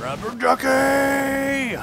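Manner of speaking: high, slow triumphant yell; excited cheer. Real, not acted. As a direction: cheer it out loud. Cheering for the duck.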